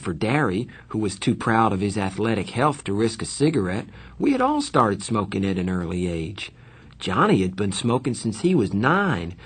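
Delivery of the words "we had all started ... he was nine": ordinary delivery, nothing marked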